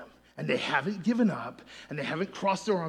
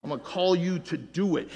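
ordinary talk, nothing marked

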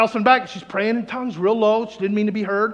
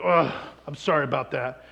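low, mildly embarrassed apology; 6 semitones lower, 4 dB quieter than usual. Retold or imitated. Southern-accented man to seatmate, embarrassed. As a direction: apologise with a touch of sheepishness. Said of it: "He's really embarrassed about it".

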